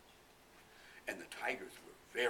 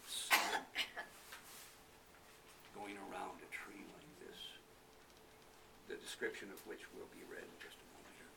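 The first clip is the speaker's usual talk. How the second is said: usual speaking voice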